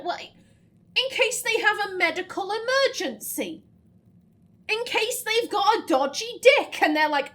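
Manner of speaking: carried-away rant. Acted. Player imagining a peasant needing a medical book, hammed-up excitement. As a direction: act it out loudly, getting carried away. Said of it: "I got carried away".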